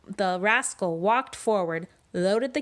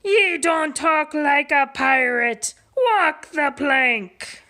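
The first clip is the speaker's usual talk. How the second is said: louder, high, slow on walk the plank; pirate order; 7 semitones higher, 7 dB louder, slower than usual. Acted. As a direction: bellow the sentence at him. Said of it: "shouted Pete".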